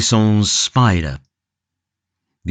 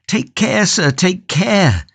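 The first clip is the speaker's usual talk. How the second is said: anxious plea; 9 semitones higher, slower than usual. Acted. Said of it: "growing quite pale".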